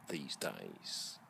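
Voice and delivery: usual speaking voice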